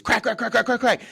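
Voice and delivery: high, loud repeated call; yelled warning. Retold or imitated. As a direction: shout the warning to teammates. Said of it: "we used to yell out crack".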